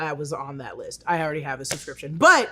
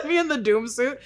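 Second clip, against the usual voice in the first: lightly voiced Doctor Doom; a hint of a villain's greeting; 5 semitones higher than usual. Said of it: "That's my Doom voice".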